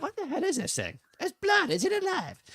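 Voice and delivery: high panicked cartoon boy; Cartman squeal. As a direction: shriek it like a grossed-out cartoon kid.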